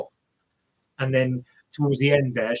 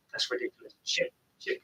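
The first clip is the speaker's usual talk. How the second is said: whispered; 11 dB quieter, faster than usual. Real, not acted. Awed at the runner's time.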